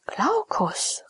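a sudden high, astonished question; surprised. Acted. Blind Nydia startled to hear Julia's beloved named, surprised. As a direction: catch the name in disbelief. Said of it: "rief Nydia verwundert aus".

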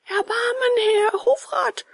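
very high, drawn-out plea; pleading. A narrator's reading. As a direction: implore in a high puppet voice. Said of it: "weinte und flehte". (hohes, gedehntes Flehen)

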